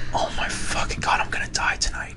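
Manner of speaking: hushed terrified whisper. Retold or imitated. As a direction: breathe it out in panic. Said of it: "and I'm like".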